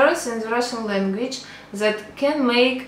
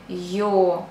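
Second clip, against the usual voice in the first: ordinary talk, nothing marked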